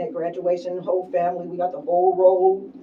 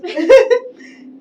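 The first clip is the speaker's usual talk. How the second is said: loud high laugh; 14 dB louder than usual. Real, not acted. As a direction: burst out laughing. Laugh after Kleenex joke.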